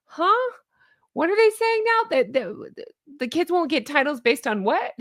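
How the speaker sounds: high incredulous huh, what; imitating baffled royal fans; mock disbelief. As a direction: squeak out the confusion.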